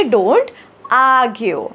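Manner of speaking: "argue" as "aa-gyu", R left out; dropped R. Shown as a demonstration. Teacher showing learners how she says it, "argue" with dropped R. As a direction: pause after "Don't", then "argue" without its R. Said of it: "र थोडासा मी तो गाळलाय उच्चार".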